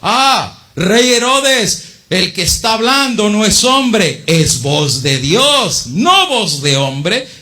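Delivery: high, slow, drawn-out acclaim; crowd acclaiming. Acted. The crowd cheers King Herod, high and drawn out. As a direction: hail the king like a fawning crowd. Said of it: "aclamaba gritando".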